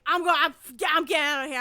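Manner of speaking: storming off. Retold or imitated. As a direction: throw it out as you walk away. Retelling girl storming out.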